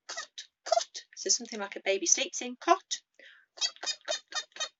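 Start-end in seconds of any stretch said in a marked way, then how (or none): 0.1-1.0 very high clucking cote; hen clucks. Shown as a demonstration. Teacher clucking high like a hen for students. 2.6-4.7 very high clucking cote; hen clucks. Shown as a demonstration. Teacher clucking high like a hen for students.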